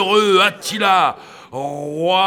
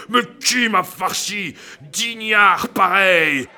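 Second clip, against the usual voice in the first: ordinary talk, nothing marked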